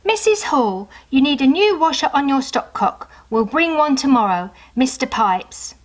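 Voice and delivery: put-on light plumber's voice in a children's story; plumber's note read aloud. A narrator's reading. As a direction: read it out like the plumber writing to Mrs Hall. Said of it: "Once she even wrote".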